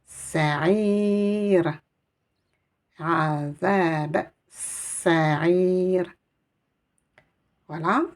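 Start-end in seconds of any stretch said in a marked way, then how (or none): 0.0-6.0 "Sa'ir" ending on a stopped r, stretched out slowly; reading as if with soukoun. Shown as a demonstration. Teacher reading the end of the verse as a pause. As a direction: finish "Azab sa'ir" slowly, closing on a bare r. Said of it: "je vais la lire comme s'il y avait une soukoun".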